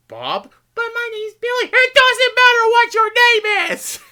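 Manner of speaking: very high booming toy-mic voice of God; toy echo microphone. Retold or imitated. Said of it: "I'm gonna use this little toy microphone".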